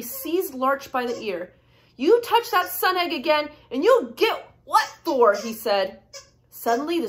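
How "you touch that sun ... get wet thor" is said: high, emphatic gnome warning; storybook gnome telling someone off; scolding threat. A narrator's reading. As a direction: punch out the threat crossly.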